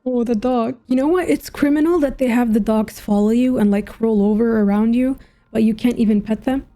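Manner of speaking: usual speaking voice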